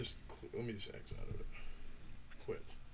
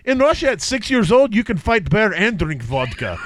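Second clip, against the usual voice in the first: put-on comic Russian accent. Host joking about bear-fighting players, Russian accent. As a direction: play a vodka-loving Russian, dropping the articles. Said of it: "Three is Russian".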